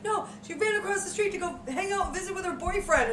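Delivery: lightly voiced caller. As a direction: lightly mimic the friend, amused. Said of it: "And she's like".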